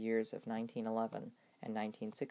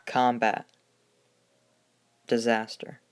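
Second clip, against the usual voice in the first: put-on old newsreel; 8 dB louder than usual. Dramatic topic list over music.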